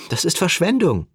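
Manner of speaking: high-pitched exclamation; exclaiming. A narrator's reading. Sadie objects to Sam about wasted buffalo, exclaiming. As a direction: cry out in lively protest. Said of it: "rief Sadie".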